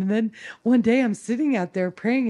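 normal talking voice

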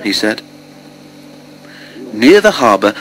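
plain reading voice

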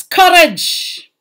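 high-pitched, energetic call; rousing exhortation. Acted. Teacher quotes Ulysses's words opening the poem, rousing cry. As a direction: launch it like a rallying cry. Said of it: "with Ulysses's words".

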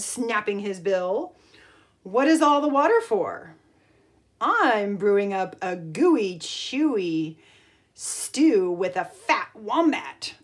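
put-on lively storybook read-aloud for kids; reading to children. A narrator's reading. Teacher reading a picture book to the class, lively storytime voice.

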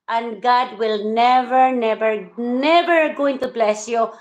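each never slow and heavy; repeated never stressed. Real, not acted. Vlogger warns rival, stressing never slowly. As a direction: hammer every never at the rival.

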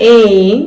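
ain said loud and clear; teacher modelling ain for children; sound of ain. Shown as a demonstration. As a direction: announce the trigraph sound ain. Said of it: "and it says".

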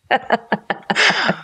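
short high chuckle; a brief, high burst of laughter; laughing. Real, not acted. Guest joking about sorting out feelings, laughing.

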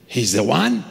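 rising, doubtful "He's the one?"; surprised question. Retold or imitated. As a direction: ask it back as if you can't believe it. Asking back in disbelief.

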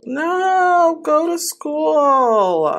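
slow, drawn-out exasperated plea. Real, not acted. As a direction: stretch it out, fed up. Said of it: "I'm so mad".

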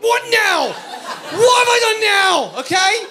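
very loud, high, stretched-out comic bellow; exasperated shouting. Acted. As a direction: yell it in exasperation, dragging the vowels. Acted outburst at the chef's reply.

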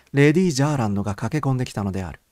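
usual speaking voice

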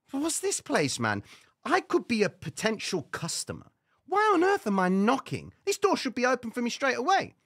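mock indignation. Indignant inner voice at door.

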